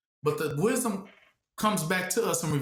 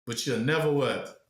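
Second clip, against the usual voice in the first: ordinary talk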